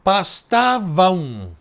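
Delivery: drawn out, syllable by syllable; slow syllable count. Shown as a demonstration. Teacher scanning syllables for students, slowly drawn out. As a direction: stretch each syllable to count it. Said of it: "já tem uma elisão".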